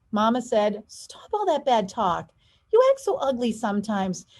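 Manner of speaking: lively storybook read, big pitch swings; animated picture book narration; reading to children. A narrator's reading. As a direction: read aloud to kids with expression. Reads picture book aloud.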